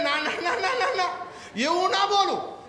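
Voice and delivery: put-on high, laughing little boy saying no; a child's protest; child refusing. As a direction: play the stubborn child, giggling through it. Child refusing in a school play.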